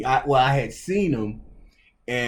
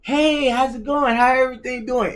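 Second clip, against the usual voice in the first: high, cheery imitation of the coach; mock friendly coach, pitched way up; coach's voice; 12 semitones higher, 3 dB louder than usual. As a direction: put on the coach's bright high greeting. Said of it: "He's like".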